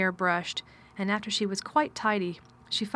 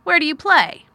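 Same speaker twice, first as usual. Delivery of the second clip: louder curt master asking; gruff man voice; 8 semitones higher, 12 dB louder than usual. Acted. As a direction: ask it with cool distance. Mr Craven asks.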